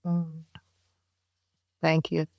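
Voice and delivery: ordinary delivery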